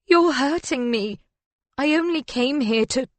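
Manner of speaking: high, pained pleading; distressed protest. A narrator's reading. Woman squeezed by the arms by her lover, distressed.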